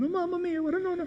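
high, wailing Mamma Mia lament; worried Italian chauffeur. Acted. Chauffeur wails in the back seat, high and fretful. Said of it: "He's worried about his job".

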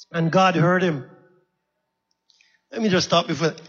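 ordinary delivery

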